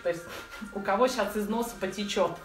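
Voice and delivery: ordinary delivery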